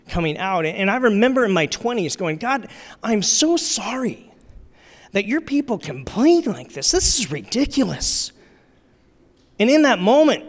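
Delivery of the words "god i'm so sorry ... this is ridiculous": higher-pitched, mock-earnest appeal to God; acted indignation, pitched up; indignant apology. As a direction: re-enact a self-righteous complaint on others' behalf. Younger self indignantly apologising to God.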